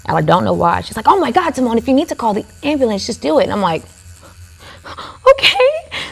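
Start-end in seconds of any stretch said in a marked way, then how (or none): 1.1-3.4 higher, quick exasperated mom; mild mock exasperation, higher and fast; annoyed mom. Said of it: "she's annoyed with me". 5.3-6.0 tiny okay. Retold or imitated. Meek reply to annoyed mom.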